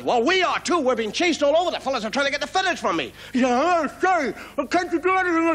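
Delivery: high, quick, fluttery complaint; a flustered bird in a hurry; fretting bird. Acted. Fantail telling the frog it is hunted too, high and fretful.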